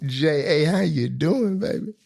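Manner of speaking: high, playful come-on; flirty greeting. Retold or imitated. Greeting Jessica Alba on screen, flirty and playful. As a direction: charm her with a cheeky hello.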